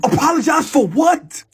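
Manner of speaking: high indignant yell at the accusation; indignant shout. Retold or imitated. Arguing with friends in dream.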